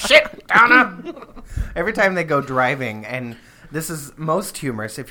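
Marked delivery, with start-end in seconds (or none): 0.1-1.1 exasperated dad yell, high and loud; dad yelling impression. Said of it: "[name] yelling at his mom in his dad's voice".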